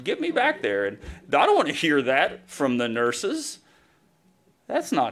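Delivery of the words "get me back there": high, pushy customer demand; patient demanding to be seen at triage, high and pushy; impatient demand. Retold or imitated. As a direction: insist like you're first in line. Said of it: "I want".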